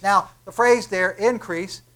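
ordinary delivery

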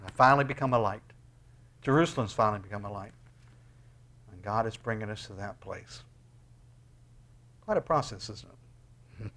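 normal talking voice

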